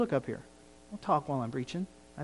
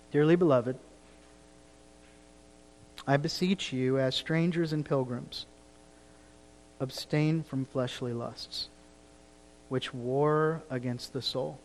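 tender reading; 2 semitones lower, 6 dB quieter than usual. Acted. Reading Peter's plea from scripture.

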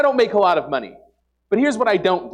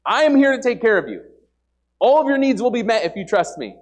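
a hint of God's voice, higher and louder; 6 semitones higher, 4 dB louder than usual. God reassures the doubting believer, lightly voiced. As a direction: reassure like God promising care. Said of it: "He's going".

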